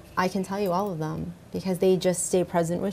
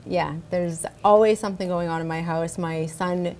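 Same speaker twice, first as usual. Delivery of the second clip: ordinary talk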